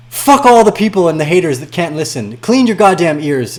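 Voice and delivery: angry rant. Real, not acted. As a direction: spit it at the haters. Ranting at haters.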